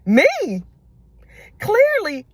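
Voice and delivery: high, drawn-out "Clearly"; scornful high "Clearly"; incredulous. Real, not acted. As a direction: swoop up in disbelief. Aunt judging the girl.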